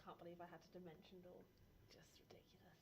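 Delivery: under-the-breath whisper; whispered aside; whispered grumble. Real, not acted. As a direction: grumble it so nobody hears. Muttered complaint.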